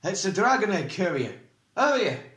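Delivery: high, loud, startled recognition; sharp alarm. A narrator's reading. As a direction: call it out sharply. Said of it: "then said sharply".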